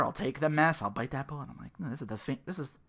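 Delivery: ordinary delivery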